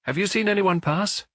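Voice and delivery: high-pitched, called question; calling out. A narrator's reading. Young duke questioning the shadowy attendant, calling out. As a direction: call the question over, voice lifted high. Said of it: "called out the duke".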